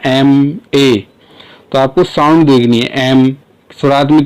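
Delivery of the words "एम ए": एम ए said as two separate, slow letters; slow, drawn-out letter names एम ए; spelling out letters. Shown as a demonstration. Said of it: "abbreviated form करें ना".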